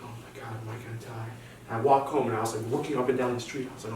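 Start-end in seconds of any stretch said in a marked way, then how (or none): 0.0-1.3 very soft, retold daze; quiet acted disbelief; dazed. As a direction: murmur it to yourself, stunned. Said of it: "walk out in a daze".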